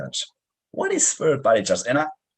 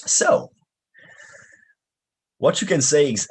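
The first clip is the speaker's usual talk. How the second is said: ordinary talk, nothing marked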